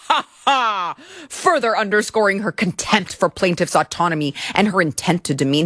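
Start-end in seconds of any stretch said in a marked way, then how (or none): 0.1-0.9 a short hammed-up taunting cackle; mock mean laugh. Reading the complaint, mock cackle of Hajini at the plaintiff. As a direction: cackle at him scornfully. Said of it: "just laughed at him".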